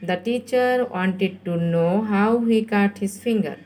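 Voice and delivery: slow, deliberate read for copying; very slow dictation. Shown as a demonstration. Teacher lets pupils write it down, very slow dictation. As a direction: stretch every word out. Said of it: "এই জন্য আমি খুব আস্তে আস্তে বলছি".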